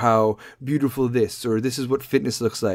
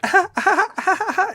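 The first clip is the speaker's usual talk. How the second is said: put-on much higher squeals of a delighted toddler; kid squealing; 3 dB louder than usual. Mom imitates the toddler shrieking at the bubbles, high squeals. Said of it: "the kid's like".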